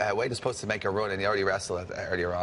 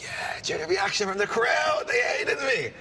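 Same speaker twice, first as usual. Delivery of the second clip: proud boast; 12 semitones higher than usual. Retold or imitated. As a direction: crow about the crowd's hate. Proudly boasting after insulting crowd.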